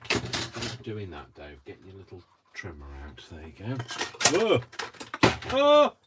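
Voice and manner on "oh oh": high, loud yelps of alarm; startled cries. Real, not acted. Crafter as it all falls, startled high yelps. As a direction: yelp as things slip away.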